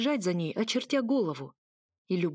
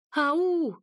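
high, loud full-throated yell; 9 semitones higher, 4 dB louder than usual. A narrator's reading. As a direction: scream it with everything. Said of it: "заорала, не жалея связок".

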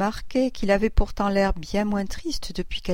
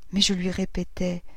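usual speaking voice

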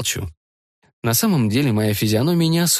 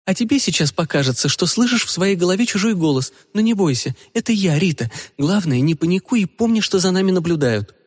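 put-on higher, light young woman's voice; 8 semitones higher than usual. Rita's telepathic voice.

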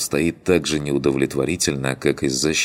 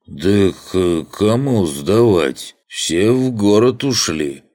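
put-on slow slurred drunk rant; cackling toothless ranting; 3 semitones higher, 4 dB louder, slower than usual. Drunk gatekeeper rants.

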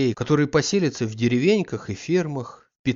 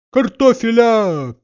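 high, loud drawn-out hawker's cry; street vendor cry; 11 semitones higher, 10 dB louder, slower than usual. A narrator's reading. Vendor in the yard calling to the houses, loud hawker cry. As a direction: call out potatoes across the yard, slowly. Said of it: "раздался со двора в пять голосов".

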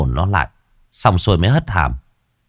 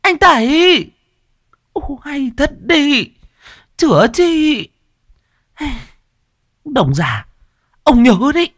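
high, angry shrieking; furious wife shouting after; angry woman; slower than usual. Acted. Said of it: "Mợ tức giận".

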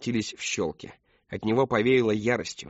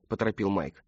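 plain reading voice

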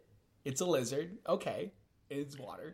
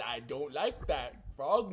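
ordinary delivery

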